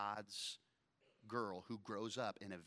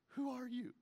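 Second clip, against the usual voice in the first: startled question; 13 semitones higher than usual. Acted. Boaz startled in the dark.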